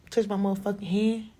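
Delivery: usual speaking voice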